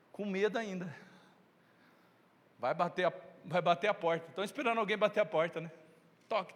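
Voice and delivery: ordinary talk, nothing marked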